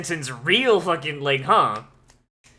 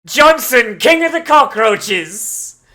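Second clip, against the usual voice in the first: much higher, much louder hype shout; hyped cheer; 5 semitones higher, 8 dB louder, slower than usual. Real, not acted. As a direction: cheer him on like a champion.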